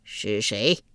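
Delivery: low-voiced judge; stern underworld king; curt royal question. A narrator's reading. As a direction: demand the name with authority.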